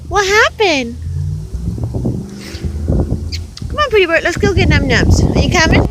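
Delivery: high, bright sing-song voice for the bird; pet talk. Acted. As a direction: coo at the bird like a baby. Coaxing the bird to come.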